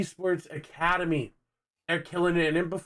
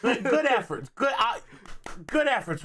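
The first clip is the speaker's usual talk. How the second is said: mock praise; 6 semitones higher than usual. Real, not acted. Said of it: "Round of applause for the efforts".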